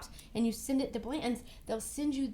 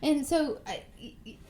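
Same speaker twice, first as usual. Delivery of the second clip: normal talking voice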